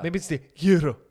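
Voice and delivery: gyro said as "yoo-ro", like a Japanese word; Japanese-sounding gyro. Real, not acted. Friend mocks his odd "gyro". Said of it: "why does it sound so Japanese".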